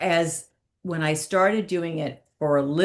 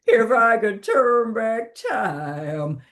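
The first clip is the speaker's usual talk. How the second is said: higher, slow [name] drawl; [name] impression; 6 semitones higher, much slower than usual. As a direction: stretch it out like [name]. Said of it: "And [name] was fabulous".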